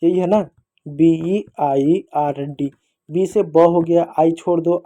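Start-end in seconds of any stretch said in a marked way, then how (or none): 0.9-2.7 very slow letter-by-letter spelling; spelled out slowly. Shown as a demonstration. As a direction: lay the letters out slowly, one at a time. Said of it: "अब कैसे हमने पढ़ा देखो". (धीरे-धीरे, हर अक्षर अलग)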